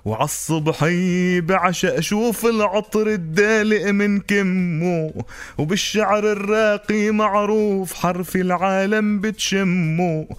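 slow stretched line ends; zajal recitation. Acted. Zajal verse reply. (إلقاء زجل)

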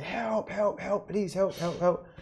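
high pleading calls for help; retold cries for help. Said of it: "I just remember saying".

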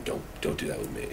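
breathy whispered plea, barely audible; whispered request. Retold or imitated. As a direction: hush it like a private warning. Quoting his request to the organizer.

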